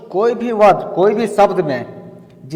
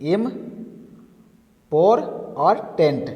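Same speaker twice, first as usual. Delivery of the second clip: syllable split. Shown as a demonstration. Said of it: "यानी कि".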